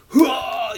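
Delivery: sudden loud startled "Hua!"; mock scare yell. Narrator acting out being grabbed, startled yell. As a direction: blurt it like you're grabbed.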